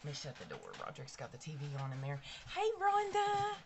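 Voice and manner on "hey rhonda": squealed much higher and louder; excited greeting. Real, not acted. Vlogger greeting a viewer who joined, squealed hello. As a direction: call out a delighted hello.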